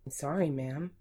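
ordinary delivery, nothing marked

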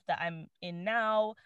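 normal talking voice

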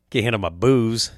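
ordinary talk, nothing marked

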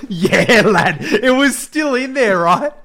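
high, loud, cracking up mid-sentence; helpless high-pitched laughing delivery; laughing while talking. Real, not acted. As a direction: tell it through big laughter. Cracking up at own story.

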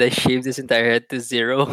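usual speaking voice

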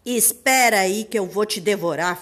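loud menacing wolf. Acted. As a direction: growl it like a hungry wolf. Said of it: "falou o lobo". (voz de lobo)

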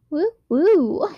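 high silly woo woo; mock cheer. Real, not acted. Teen mocking her own date reading, goofy high cheer. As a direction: cheer it like a goofy party horn.

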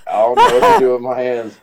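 lightly voiced bewildered racer, laughing through it; a hint of Ricky Bobby at a loss, giggling. Friend quoting Ricky Bobby, mildly giggling. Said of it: "I'm playing a Ricky Bobby".

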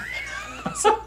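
squealing burst of laughter; high-pitched laugh. Real, not acted. Amused reaction to the formol story.

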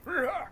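high playful scare roar; a hammed-up scare yell; mock monster roar. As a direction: jump out with a fake roar. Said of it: "wear the mask going".

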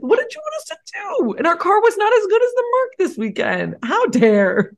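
high-pitched outraged squeal; squeal volume. Real, not acted. To co-host mocking Ferrari, squealing in outrage. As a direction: defend the team at top pitch. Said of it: "You've activated squeal volume".

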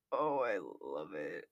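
very low, slow bass line; extremely deep bass voice. Shown as a demonstration. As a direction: sink to the bottom of the range. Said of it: "That was sad".

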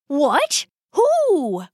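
high, startled questions from a rat papa; startled father. Acted. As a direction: jump on the news in alarm. Father startled by daughter's confession.

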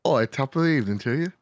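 hammed-up British greeting; British accent. Shown as a demonstration. Guest shows off his best British accent to the hosts, hammed up. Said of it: "my best British accent".

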